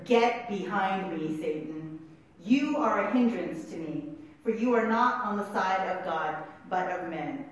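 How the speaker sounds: normal talking voice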